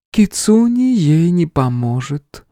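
slow, high goddess tone; female voice. A narrator's reading. Goddess speaks in hero's head, feminine voice. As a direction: float it out in feminine pitch. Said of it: "Вновь женский голос в голове".

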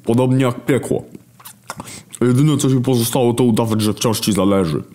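mock the Creator; Hunger. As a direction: Hunger sneers at God. Hunger accuses God.

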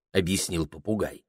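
ordinary delivery, nothing marked

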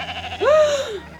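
sharp high gasping shout; sudden pained high cry; labour gasp. Acted. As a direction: gasp out as the pain hits. Maria in labour pains.

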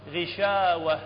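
غشاوة with the long aa of the dagger alif held; correct reading. Shown as a demonstration. Teacher showing students the right reading: غشاوة with long aa. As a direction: say غشاوة, stretching the hidden alif. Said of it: "هذا الألف يسمونه الألف الخنجري".

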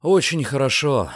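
ordinary delivery, nothing marked

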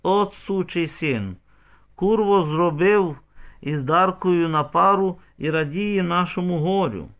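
loud, high, slowed indignation; angry accusation. A narrator's reading. Crowd blames the father for their grief, angry. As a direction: hurl the curse, leaning on the words.

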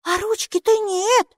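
very high little voice; high, surprised small creature; little character remarking. A narrator's reading. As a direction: point it out in a high pipe. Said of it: "заметила Майки".

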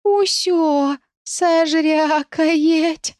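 high, very slow whimper; whimpering sob. Acted. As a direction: whimper like a terrified kitten. Scared cat hiding.

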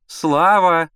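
loud, high drawn call for a son; insistent motherly shout. A narrator's reading. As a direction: summon him from another room. Mother calling son from bedroom.

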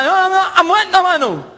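high, frantic garbled voice; garbled caller. Acted. Caller reporting to a man who understands nothing, garbled. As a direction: babble urgently in a high voice.

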